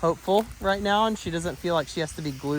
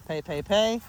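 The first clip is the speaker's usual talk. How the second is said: ordinary talk, nothing marked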